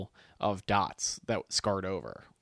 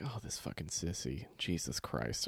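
muttered disgust; 6 semitones lower, 11 dB quieter than usual. Retold or imitated.

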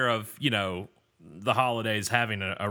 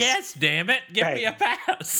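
mock demanding; 10 semitones higher, 4 dB louder, slower than usual. Jokingly demanding a holiday pass.

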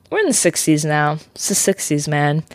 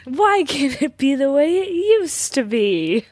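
high, slow dramatic plea; shouting capitalized words; 9 semitones higher than usual. Shown as a demonstration. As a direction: wail it like a heartbroken spouse. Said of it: "Can you say it again?".

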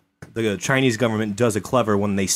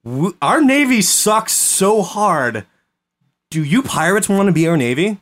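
mock pleading government; 7 semitones higher, 3 dB louder than usual. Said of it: "the Qing Dynasty was like".

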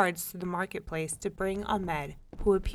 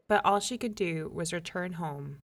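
usual speaking voice

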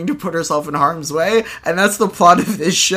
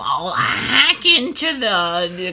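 mock kid voice; 4 dB quieter, much slower than usual. Mocking the voice acting.

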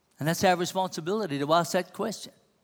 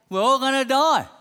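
higher and louder proclamation; forceful declaration; 6 semitones higher, 5 dB louder than usual. Real, not acted. Preacher warns the congregation of death, forceful. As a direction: proclaim it to the hall.

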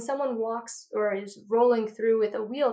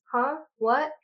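mock confused huh; 4 semitones higher than usual. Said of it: "Do not say what you've been saying".

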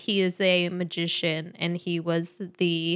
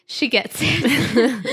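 teasing; 4 semitones higher, 6 dB louder, slower than usual. Pretend. Teasing about co-host.

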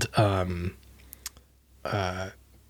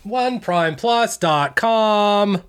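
slow, higher, drawn-out plug; announcer plug; 10 semitones higher, much slower than usual. Shown as a demonstration. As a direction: stretch every word like a radio promo. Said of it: "echoing the plug slowly".

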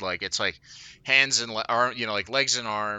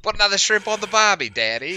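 mock Australian; 10 semitones higher, 7 dB louder than usual. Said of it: "the most Australian thing ever".

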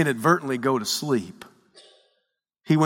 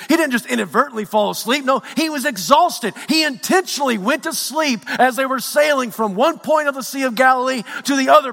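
high-pitched, driving sermon delivery; impassioned preaching; 8 semitones higher than usual. Acted. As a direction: build the pain line by line like a fired-up preacher. Preaching at full force.

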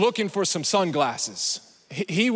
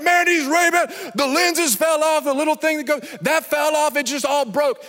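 imitating an upset buyer, higher pitched; mock-exasperated griping in a raised voice; retold upset complaint; 5 semitones higher than usual. Friend complains to pastor about broken sunglasses, upset. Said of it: "And he was so upset".

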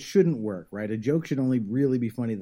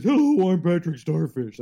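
louder, dopey cartoon starfish; Patrick Star; 6 semitones higher, 7 dB louder than usual. Shown as a demonstration. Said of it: "that real stupid voice".

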